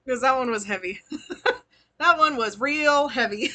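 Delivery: giggling through the words; can't stop laughing as you speak. Real, not acted. Laughs at the heavy reading.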